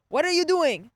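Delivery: high, loud mock interrogation; imitated professor. Professor questioning student before the whole lab, loud imitated demand. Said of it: "He's like".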